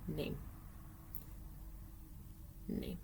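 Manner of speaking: half-voiced "name" to go with the sign; mouthed with the sign. Shown as a demonstration. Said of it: "And you're gonna go".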